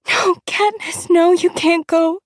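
very high, drawn-out pleading wail; hysterical child. A narrator's reading. Little sister clinging to her sister, hysterical pleading. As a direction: wail it in a little girl's panic. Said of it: "Prim is screaming hysterically behind me".

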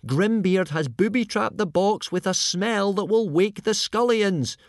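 high, loud urgent warning; panicked high cry; shouted. A narrator's reading. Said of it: "shouted Hiccup".

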